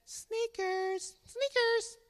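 high mock girl voice; imitating Gracie. Dad imitating daughter's answer. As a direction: chirp it like his daughter. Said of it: "Gracie said".

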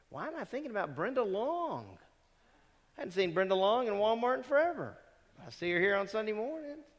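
high-pitched, animated thinking aloud; puzzled wondering. Acted. Preacher acting a driver suddenly reminded of someone, puzzled wondering. Acts out wondering while driving.

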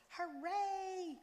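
high, loud joyful cheer. A narrator's reading. Brother cheers on reaching the empty store window, high and joyful. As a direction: burst out in delight. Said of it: "my brother cheered".

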